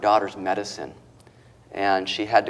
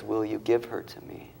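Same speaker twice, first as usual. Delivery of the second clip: usual speaking voice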